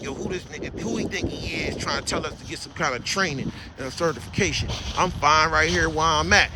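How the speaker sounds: low, quiet grumble of someone offended; muttering resentful mimic. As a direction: mumble it like a sulking hater. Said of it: "Y'all get mad".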